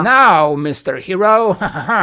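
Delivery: a lightly hammed-up old-movie villain gloat; mustache twirling villain. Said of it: "twirling his, his mustache, like".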